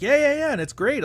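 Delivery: high squeaky eager fan voice; high mocking voice of an overexcited fan. Imitating eager collector.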